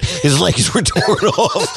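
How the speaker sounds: laughing punchline. Shown as a demonstration. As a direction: crack up while delivering grim news. Laughs at own grim punchline.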